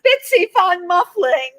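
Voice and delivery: high, slow, savoured name; grand drawn-out name. Pretend. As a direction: relish the name like a grand dame. Cohost savours the character name.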